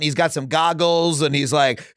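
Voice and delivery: normal talking voice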